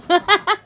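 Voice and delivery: high, loud squeal of laughter; sudden high-pitched laughing fit; shrieking laugh. Real, not acted. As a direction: burst out in a shrill cackle. Burst of laughter.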